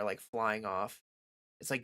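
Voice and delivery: ordinary talk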